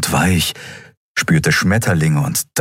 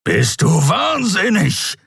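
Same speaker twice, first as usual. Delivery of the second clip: slow, incredulous growl with raised pitch; exasperated creature snarl; 12 semitones higher, slower than usual. A narrator's reading. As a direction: growl it, stretching the words. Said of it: "knurrte der Skria".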